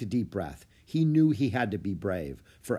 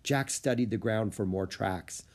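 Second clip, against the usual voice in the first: ordinary delivery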